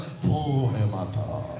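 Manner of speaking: very low, drawn-out वो and माता; pointed slow line. Shown as a demonstration. Teacher driving the point home, very slow and low. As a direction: stretch every word low and long. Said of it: "ये क्या बोल रहा है मालूम है".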